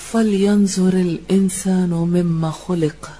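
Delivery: measured tajweed recitation of a verse; Quranic recitation. Real, not acted. Teacher reciting a verse to her students, tajweed-style recitation. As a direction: recite the verse with careful Arabic articulation.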